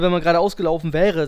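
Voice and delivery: usual speaking voice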